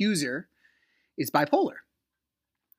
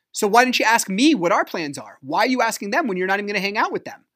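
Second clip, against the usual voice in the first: higher, louder and fast, replaying the fight; husband reliving the fight, shouting at his wife; retold angry yell; 7 semitones higher, 8 dB louder, much faster than usual. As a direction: fire the questions at her in a fast, raised voice.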